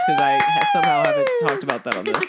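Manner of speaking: very high, fast gush; excited squeal. Real, not acted. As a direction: squeal it out in delight. Excited squeal about fiancé.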